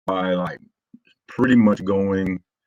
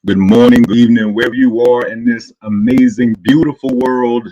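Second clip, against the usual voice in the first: hype stream intro; 2 semitones higher, 9 dB louder than usual. Real, not acted. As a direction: greet the whole Wolfpack like an arena announcer. Hyped stream opening greeting to chat.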